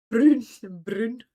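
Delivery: playful Scottish "Brune", vowel as oo; imitated Scottish accent. Friend practising a favourite word, imitated Scottish accent. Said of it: "favourite words to try and say with a Scottish accent".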